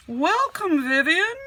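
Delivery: loud, high, cheerful teacher greeting; Mister J calling out. Acted. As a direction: call out a big welcome to the class. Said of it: "called Mister J".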